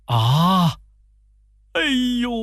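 hammed-up high wail. A narrator's reading. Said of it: "放声大哭起来".

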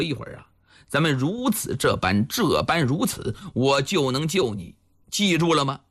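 low conspirator's voice; Chao Gai, confiding. Acted. Village chief secretly coaching the captive, low and confiding. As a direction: lay out the plan in a low voice.

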